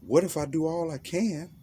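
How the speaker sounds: high, worried voice of a doubting listener; questioning listener. Retold or imitated. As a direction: ask it as an anxious young listener. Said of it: "voicing a listener's question".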